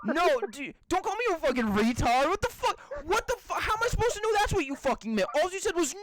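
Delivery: high-pitched exasperated protest; indignant. Real, not acted. Defending himself to a friend who insulted him, indignant. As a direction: argue back heatedly, voice climbing.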